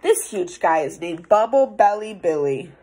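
ordinary delivery

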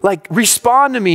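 acted upset question, voice lifted; retold emotional plea. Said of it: "I'm just all emotional".

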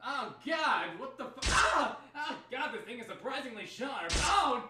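a brawl heard far in the distance; distant scuffle. Acted. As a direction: shout from another room. Fight heard from far away.

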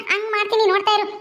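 ordinary talk

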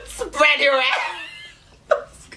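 usual speaking voice

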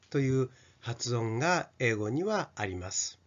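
usual speaking voice

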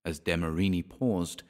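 usual speaking voice

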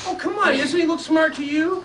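loud, high, hammed-up protest; mock-indignant coaxing. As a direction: coax the kids with fake outrage. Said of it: "you can play along with me".

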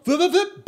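high quick vips of the belt; high vip sound effect. Retold or imitated. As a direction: squeak out the treadmill noise.

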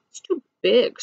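lightly squawked auk line; squawking. A narrator's reading. Auk turning down the egg, mild squawk. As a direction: give the objection a mild squawk. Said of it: "squawked the auk".

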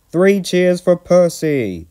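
high, loud, drawn-out cheer; rousing hearty call. A narrator's reading. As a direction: lead a crowd in a cheer. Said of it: "called the vicar".

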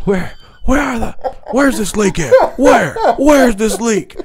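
high, put-on voice, laughing through it; mock crackhead voice. Imitating the crackhead brother under the sink, high and laughing. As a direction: ham up the clueless addict hunting the leak. Mimics brother searching for leak.

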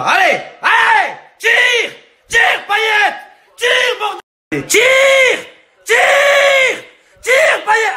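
very high, urgent shouts; frantic fan yelling. Acted. Football fan urging Payet to shoot, frantic yelling. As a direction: scream at the player to shoot.

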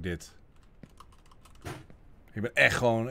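ordinary delivery

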